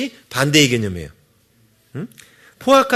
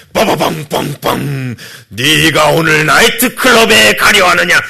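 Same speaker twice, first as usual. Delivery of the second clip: mock booming, slow and louder divine rebuke; stern thundering God; 7 dB louder, slower than usual. Preacher mocking a stern God scolding a nightclub-goer. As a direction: thunder it out like a judging deity. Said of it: "근엄하게 번개치면서".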